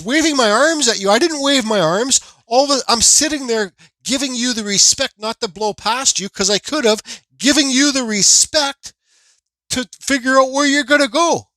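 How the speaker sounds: loud, high indignant argument; retold angry comeback. Accused driver defending himself to the other driver, retold angry comeback. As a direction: argue your innocence heatedly, replaying the row.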